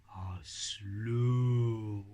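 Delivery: low, drawn-out slowness; slow tortoise. Shown as a demonstration. Teacher describing his tortoise puppet, dragging the words slowly. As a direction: stretch it out like a tortoise. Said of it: "So he speaks slowly".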